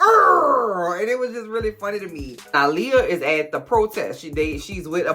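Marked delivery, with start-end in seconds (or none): 0.0-1.0 hammed-up loud high "Arr!" growl; mock growl. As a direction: growl it like a grumpy little marcher. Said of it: "she was like".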